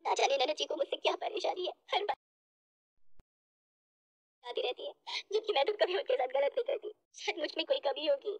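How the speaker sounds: subdued, tearful wondering; crying to herself. Acted. Bahu wondering why she is disliked, tearfully. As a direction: sob softly through self-doubt. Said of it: "खूब रोती है और मन ही मन सोचती है".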